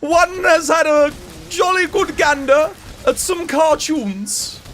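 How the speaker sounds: high, slow, hammed-up upper-class English; upper-class British accent. Shown as a demonstration. Streamer mocking upper-class speech after laughing, exaggerated high upper-class accent. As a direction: stretch it out in a mock-posh British accent. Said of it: "certain class of people that pronounce things like that".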